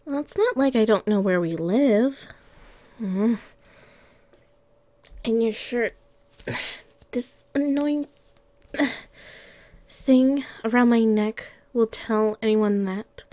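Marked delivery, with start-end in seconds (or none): none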